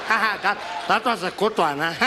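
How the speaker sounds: put-on tipsy old statesman, chuckling; pleased drunk elder impression, laughing into words; Madiba. Drunk host proud of his joke, chuckling impression. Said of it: "get totally wasted on his birthday".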